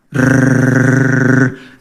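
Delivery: long rolled R motor noise; imitated fan whirr. Husband shows how the repaired fan briefly ran, whirring. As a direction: trill a steady, low-key machine hum.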